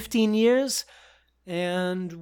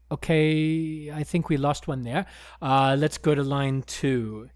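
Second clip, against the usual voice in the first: ordinary talk, nothing marked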